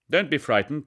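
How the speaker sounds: a hint of a hearty father. A narrator's reading. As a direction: settle the boy down. Father reassures son.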